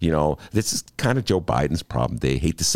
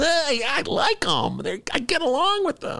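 Biden impression. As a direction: squeal it like a naive, friendly pushover. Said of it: "that was an impression of you".

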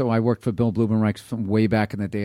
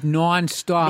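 "nonstop" in a Boston accent, drawn out slowly; slow, higher Boston-accent "nonstop"; 6 semitones higher than usual. Shown as a demonstration. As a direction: show off the rounded Boston vowel in "nonstop". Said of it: "No, but you got it good".

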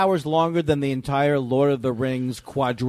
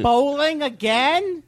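incredulous; 9 semitones higher, much slower than usual. Retold or imitated. Mocking Todd's excuses.

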